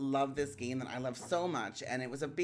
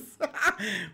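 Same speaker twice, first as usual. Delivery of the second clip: giddy laugh; 6 semitones higher, 6 dB louder than usual. Real, not acted. Laughing at own stream.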